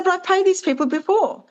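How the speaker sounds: high, indignant protest. Retold or imitated. Customer protesting to the bank, high and indignant.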